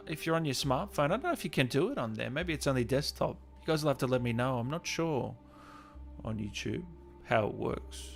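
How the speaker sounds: ordinary talk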